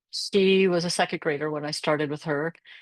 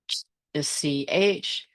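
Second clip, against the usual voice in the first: ordinary delivery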